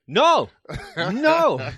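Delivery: loud high alarmed "no, no"; mock-panicked dad shouting no. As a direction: stop her in a hurry, loud and high. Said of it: "he's like".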